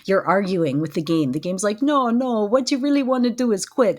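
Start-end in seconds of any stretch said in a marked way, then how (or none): 1.8-4.0 higher-pitched, hint of a comic French accent; funny French accent. Game narrator urging the player to quit, mock French accent. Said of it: "in, like, a funny French accent".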